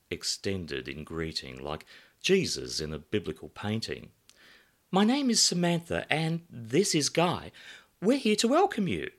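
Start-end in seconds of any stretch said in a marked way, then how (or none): 4.9-9.1 high, bright welcoming hostess; glamorous hostess voice. Acted. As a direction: greet guests like a bombshell hostess.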